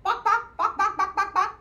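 loud, rapid-fire clucks; perky staccato bok-boks; chicken clucking. Shown as a demonstration. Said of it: "And chickens say".

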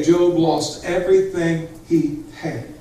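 ordinary talk, nothing marked

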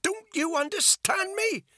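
very high, loud, frantic; desperate shout. A narrator's reading. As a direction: shriek it at him in despair. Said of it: "Bethany shouted".

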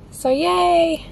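high, happy little yay; stoked cheer. Real, not acted. Vlogger thrilled with her freshened dreadlocks, stoked cheer. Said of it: "really, really stoked".

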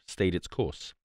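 usual speaking voice

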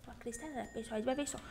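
soft, low secret aside; whispered aside. Acted. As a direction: let viewers in on it.

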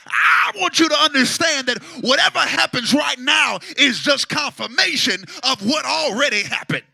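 loud, high ringing sermon; preaching at full force. Acted. Preacher shouting about favor to the congregation. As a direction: shout the truth to the church.

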